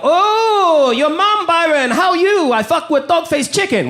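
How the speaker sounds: put-on high-pitched broken-English taunt in a Chinese accent; mock Chinese accent. ESL classmates picking on Byron, mock Chinese accent. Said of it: "a lot of them were very E S L".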